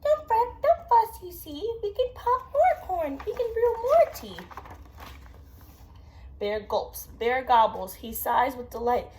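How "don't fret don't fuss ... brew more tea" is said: very high, louder squeak of a tiny mouse; squeaky mouse voice. Acted. Parent voicing the mouse for a child, very high squeaky voice. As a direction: squeak it out like a fussy little mouse. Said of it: "Mouse squeaks".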